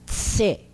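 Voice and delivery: "Tse" drilled alone with t fused into s; teacher's demonstration of "Tse", ts onset. Shown as a demonstration. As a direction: model "Tse" with the pizza ts sound. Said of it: "tem o som de tse".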